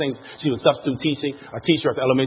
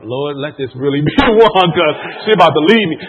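mock prayer; 7 dB louder than usual. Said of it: "I was praying to myself".

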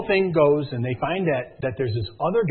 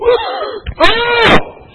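loud, high gasp-scream; shocked scream; 11 dB louder than usual. Acted. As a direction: scream in shock as the clue appears. Acts viewer's shock at the reveal.

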